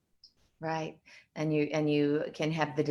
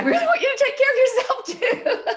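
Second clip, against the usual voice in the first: much higher, louder laughing plea; pleading mom, laughing; 6 dB louder than usual. Retold or imitated. Mother urging her studying daughter, high laughing plea. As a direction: beg her to look after herself through a laugh. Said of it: "I'm like".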